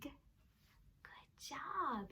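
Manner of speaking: soft whispered good job; whispered praise. Acted. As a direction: breathe the praise softly.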